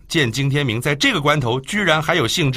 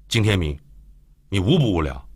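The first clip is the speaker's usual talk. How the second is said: irritated retort; 4 semitones lower than usual. Acted. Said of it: "没好气地回道".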